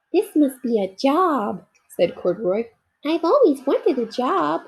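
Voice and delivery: a toy bear thrilled to have a job; proud little bear. Acted. Corduroy at the newsstand.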